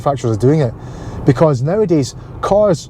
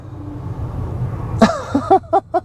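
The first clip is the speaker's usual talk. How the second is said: squeaky, airless giggle much higher; high breathless laugh; 4 dB quieter than usual. Real, not acted. Laughing at his own accent rant, high breathless giggle. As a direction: let a high wheezy laugh escape.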